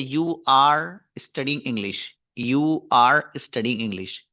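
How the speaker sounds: slow, word-by-word "Are you studying English?" while writing on the board; slow dictation. Shown as a demonstration. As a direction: dictate each word slowly for the class. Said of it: "helping verb". (Board पर लिखते हुए धीरे-धीरे बोलना)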